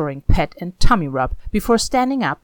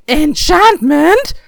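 high, loud, drawn-out yell; a boy's excited yell, stretched long; yelling back; 10 semitones higher, 10 dB louder, much slower than usual. A narrator's reading. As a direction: shout it back over your shoulder while chasing a cat. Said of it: "Sandal yelled back".

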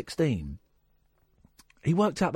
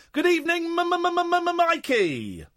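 announcer greeting; 12 semitones higher than usual. Acted. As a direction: ham up the stuttered welcome. Hammed-up radio greeting.

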